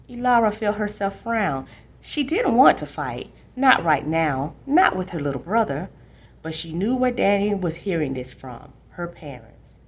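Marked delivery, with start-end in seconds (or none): none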